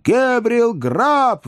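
very high, drawn-out screech; shrieking wild chorus. A narrator's reading. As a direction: squeal the name like a crowd of spirits. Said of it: "взвизгнул дикий хор голосов".

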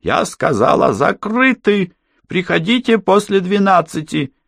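very high, stretched-out barmaid's cry; woman shouting again. A narrator's reading. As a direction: cut off the objection with a high scolding call. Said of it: "снова крикнув". (раздражённый высокий женский крик)

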